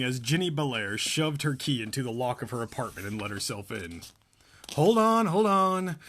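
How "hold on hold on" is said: hurried call. Acted. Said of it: "she muttered".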